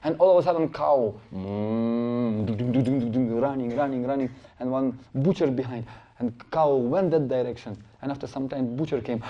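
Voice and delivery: ordinary talk, nothing marked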